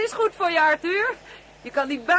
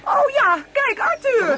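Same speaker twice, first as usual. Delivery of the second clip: high, loud, agitated call to her husband; startled and a little panicky; alarmed cry; 7 dB louder, slower than usual. Real, not acted. Wasps swarm around them.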